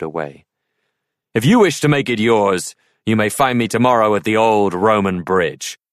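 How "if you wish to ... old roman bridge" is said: louder, bold knight calling back; tossing a challenge over his shoulder; knight's parting challenge to Arthur; jaunty knight. A narrator's reading. Knight's voice riding away.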